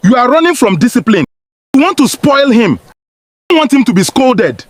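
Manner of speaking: acted angry father laying blame; raging husband. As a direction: berate your wife in quick bursts. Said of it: "he raged".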